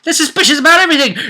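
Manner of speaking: comic high-pitched echo; high fast repeat. Shown as a demonstration. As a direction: squeak it out in a rush. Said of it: "suspicious with him".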